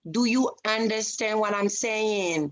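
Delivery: slow, spelled-out condescending question; slow condescension. Pretend. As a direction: talk down to the caller, word by word. Clerk condescends to foreign caller.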